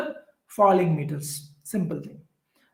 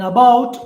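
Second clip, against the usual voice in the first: "about" with "bout" punched louder; weak "a", strong "bout" in "about"; stress on second syllable; 4 semitones higher, 9 dB louder than usual. Shown as a demonstration. Teacher giving an iamb example, "about" stressed on "bout". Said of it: "bout is stressed".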